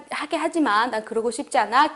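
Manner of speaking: ordinary talk, nothing marked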